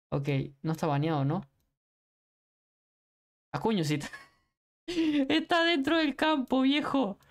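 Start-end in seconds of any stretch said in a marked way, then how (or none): 5.3-7.2 high-pitched laughing words. Real, not acted. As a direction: laugh through the line. Laughing at a game moment.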